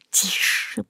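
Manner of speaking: soft, unvoiced hiss of a warning; the cat hushes the dog so it won't wake the boy. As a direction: hiss it so the boy stays asleep. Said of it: "прошипел кот".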